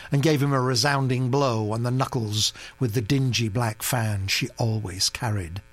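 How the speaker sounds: usual speaking voice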